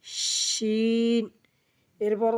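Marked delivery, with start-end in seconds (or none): 0.0-1.2 শিন with long, thick sh and drawn-out vowel; thick airy shin. Shown as a demonstration. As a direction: push a thick stream of air into শিন. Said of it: "একটু মোটা করে বাতাস বের হবে". (মোটা করে বাতাস ছেড়ে শিন)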